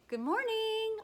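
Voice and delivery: bright high good morning; cheery greeting. Acted. Neighbour greeting on a walk, high and cheery. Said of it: "I say".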